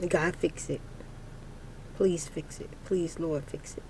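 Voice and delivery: soft, pleading prayer under the breath; hushed prayer. Real, not acted. Praying to God alone in the morning, soft and pleading. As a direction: pray quietly, begging God to fix it. Quiet prayer while driving out.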